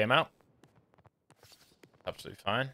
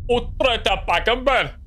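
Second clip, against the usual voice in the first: mock Uhtred accent; 6 semitones higher, slower than usual. Mocking actor's odd accent.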